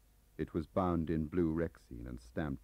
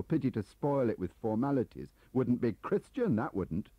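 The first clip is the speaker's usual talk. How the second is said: louder, higher biting sarcasm; mock Welsh piety, scornful; sarcastic anger; 8 semitones higher, 6 dB louder than usual. Turner berates Gaunt sarcastically.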